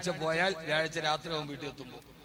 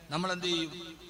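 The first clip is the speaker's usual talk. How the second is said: ordinary talk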